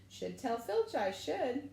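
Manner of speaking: slow, high mock-innocent singsong; saintly voice. A narrator's reading. Peeves threatening to tell Filch, saintly voice. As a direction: play the little saint, slowly. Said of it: "said Peeves in a saintly voice".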